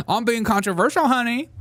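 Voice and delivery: high, loud sassy "controversial, honey"; campy self-mockery; sassy tease. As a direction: sass it up with a grin. Mock sassy self-aware aside.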